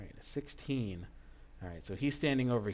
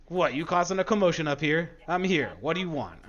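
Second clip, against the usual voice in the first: loud higher demand; confronting bandit; 7 semitones higher, 8 dB louder than usual. Acted. Bandit leader confronts the intruders, loud challenge. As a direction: square up and shout it.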